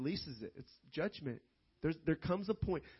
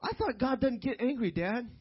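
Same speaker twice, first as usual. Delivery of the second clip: lightly imitated child, pitched higher; a hint of a puzzled kid, higher pitch; son's questioning voice; 8 semitones higher, 4 dB louder than usual. Son questions his dad about God, lightly voiced higher. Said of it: "and my son, he, he said".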